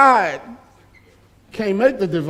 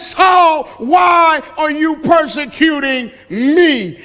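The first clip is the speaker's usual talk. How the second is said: shouted voice of Jesus; 5 semitones higher, slower than usual. Retold or imitated. Preacher booming Jesus's question to Saul at the congregation. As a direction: call it out like the voice from heaven. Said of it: "What he says is".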